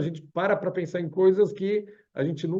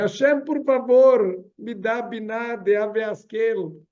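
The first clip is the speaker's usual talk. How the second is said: mock prayer plea; 5 semitones higher, 4 dB louder, slower than usual. Person prays to Hashem again.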